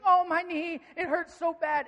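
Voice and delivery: high, loud whiny wail; mock crying child. Son in timeout wanting attention, mock loud crying. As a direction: wail like a kid milking an old boo-boo. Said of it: "he'll start crying really loud".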